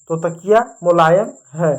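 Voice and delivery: ordinary talk, nothing marked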